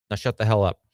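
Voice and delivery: ordinary talk, nothing marked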